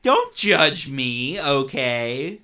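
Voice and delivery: loud, slow playful protest; mock defensive. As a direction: drag it out, teasingly defensive. Mock defensive retold reply.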